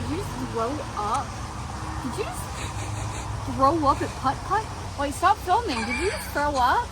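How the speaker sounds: high, loud disbelief; shocked. Real, not acted. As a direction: react in shock. Shocked at someone vomiting.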